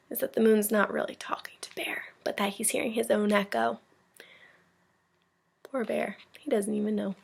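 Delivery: whispered secret aside. Real, not acted. Teacher's aside to listeners.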